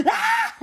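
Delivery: very high squeaky shriek; a tiny creature's sharp scream; Gizmo shriek. Retold or imitated. Gizmo startled by the camera flash, a high squeaky shriek. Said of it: "Gizmo's like".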